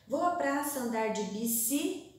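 "bici-" left hanging on a rise; broken off mid-word. Shown as a demonstration. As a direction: cut the word after a lifted "bici-". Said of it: "não deu mais ali naquela linha". ("bici-" suspenso, palavra cortada no meio)

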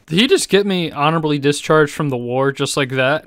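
usual speaking voice

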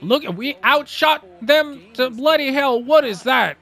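frustrated outburst. Real, not acted. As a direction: vent at the unfair result. Furious at losing the game.

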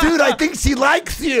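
high, laughing retold punchline; loud, amused delivery of the friend's line; cracking up. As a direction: barely get the line out through laughter.

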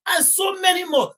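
a sudden shout of grief over the losses; anguished outcry. Real, not acted. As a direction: cry it out high and loud in disbelief. Listing the dead, outcry.

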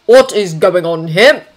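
slow, loud, indignant question; stern demand. A narrator's reading. Father bursts into his daughter's bedroom, stern demand. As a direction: demand an explanation slowly. Outraged father arrives at the scene.

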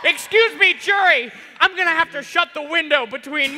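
laughing shout. Acted. Judge yelling over the noise.

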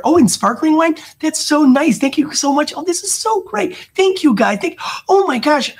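high, bubbly over-the-top praise; gushing dinner guest. Retold or imitated. Guests raving to the host about dinner, high gushing voice. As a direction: rave like a thrilled guest, voice up high. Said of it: "what are the people gonna ask you?".

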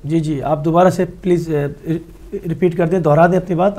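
speaking up to a bad line. Real, not acted. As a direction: raise the voice so the caller hears. Asking caller to repeat.

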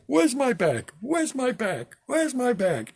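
high, fretful repeated question; frantic passenger. Retold or imitated. Guy on the sinking plane worrying about luggage, mock frantic. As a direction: whine for the lost bag.

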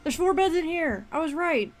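loud and high, triumphant; excited. Real, not acted. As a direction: shout it like you won. Triumphant discovery in game.

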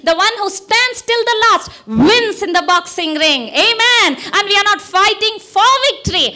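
high, loud preaching at full force; fiery preaching. Acted. Preacher exhorts congregation at full force.

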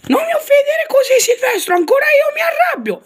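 tiny canary yelling back, high and fast; squeaky little bird. Acted. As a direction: chirp it out indignant and quick. Tweety answering Sylvester.

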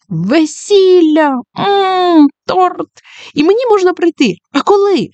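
put-on high squealing child voice; excited kids. Children thrilled at the teacher's wedding news, high squeals. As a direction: squeal with delight like a little kid.

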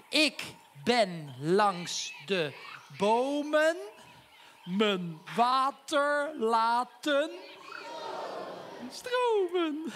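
slow, drawn-out poem recital; reciting a rhyme. Pretend. Bobby reads his rhyming note to Ernst, drawn-out recital. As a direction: stretch each word like a silly poem. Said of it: "Ik maak er een rijmpje van".

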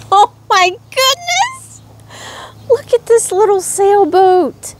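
very high, delighted squeal; excited squeal. Real, not acted. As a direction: shriek with joy at the surprise. Opening mystery bag.

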